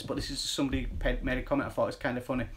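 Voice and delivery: normal talking voice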